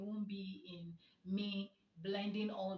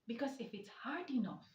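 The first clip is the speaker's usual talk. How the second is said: ordinary talk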